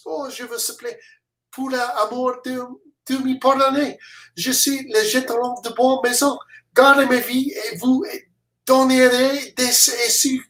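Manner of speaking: begging French soldier. Acted.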